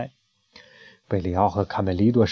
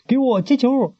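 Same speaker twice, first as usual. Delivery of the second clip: high, joyful shouting cheer; boy cheers as they score; happy cheering; 7 semitones higher than usual. Acted. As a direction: cheer the goal happily. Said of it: "开心地欢呼着".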